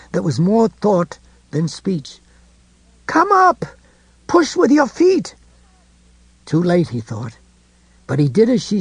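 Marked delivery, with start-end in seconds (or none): 3.1-5.4 eerie urgent call. A narrator's reading. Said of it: "in that eerie, penetrating way".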